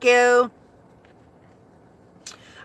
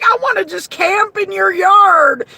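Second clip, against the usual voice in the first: put-on high, louder kid-like whine; mock whiny insisting; 7 semitones higher, 6 dB louder than usual. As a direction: insist like a stubborn child. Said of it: "I'm like".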